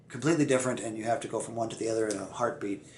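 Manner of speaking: usual speaking voice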